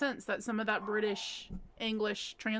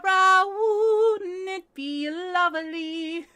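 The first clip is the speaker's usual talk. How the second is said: put-on sung slowly in a Cockney accent, higher; 4 semitones higher, much slower than usual. Singing My Fair Lady in a Cockney accent. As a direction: belt it like a Cockney flower girl. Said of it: "I learned how to do a Cockney accent".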